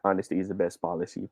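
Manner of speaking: low, quiet, quick monotone. Shown as a demonstration. Teacher showing how it sounds without emphasis, monotone. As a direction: run it through without any emphasis, softly. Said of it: "ganun pag monotone".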